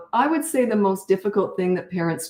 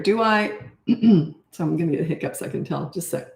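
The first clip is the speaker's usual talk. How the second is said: ordinary talk, nothing marked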